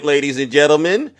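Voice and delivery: ordinary delivery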